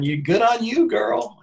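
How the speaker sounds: put-on high-pitched girlfriend cheer; imitating cheering girlfriends. Voicing girlfriends egging her on, mock cheering.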